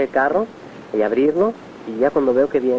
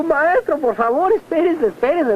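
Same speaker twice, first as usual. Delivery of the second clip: much higher, urgent begging to wait; alarmed plea; 13 semitones higher, slower than usual. Retold or imitated. Follower begging the master not to climb onto the stretcher, alarmed high plea. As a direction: stop him in a panic, pitched way up.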